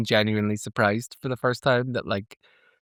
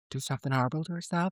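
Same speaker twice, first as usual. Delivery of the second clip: hushed eerie quote; 3 semitones higher, 9 dB quieter, faster than usual. Retold or imitated. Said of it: "Dagny delivers that line".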